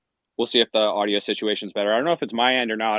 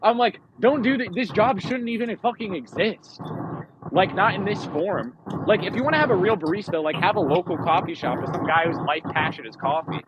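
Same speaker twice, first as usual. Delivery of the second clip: high-pitched, worked-up protest; exasperated rant; 10 semitones higher than usual. Retold or imitated. Customer argues the barista job shouldn't exist, exasperated rant.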